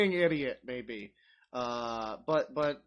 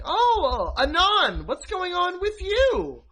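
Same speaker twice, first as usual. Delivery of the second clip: much higher, slow, sing-song aunt voice; nosy aunt; 10 semitones higher, 4 dB louder, slower than usual. Retold or imitated. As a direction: chirp it like a doting aunt at Thanksgiving. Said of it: "your aunt or whatever is like".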